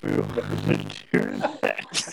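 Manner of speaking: very low, glitchy robotic drone. Real, not acted. Host talking to co-hosts on a bad call, robot voice. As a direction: talk like a garbled machine. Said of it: "doing something robot".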